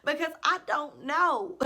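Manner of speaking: mock pleading. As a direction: beg them for answers in mock desperation. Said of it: "And I'm just like".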